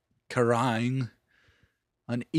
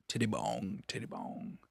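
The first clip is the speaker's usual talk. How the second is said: whispered; 4 semitones lower, 12 dB quieter than usual. Pretend. Savoring the name softly.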